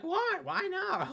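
very high mock protest; put-on high, pleading question; imitated female friend. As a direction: imitate her protesting in a falsetto.

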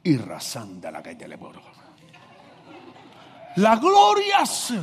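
mocking churchy preaching tone; mock preacher voice. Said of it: "Jesús no hablaba así".

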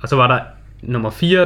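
ordinary talk

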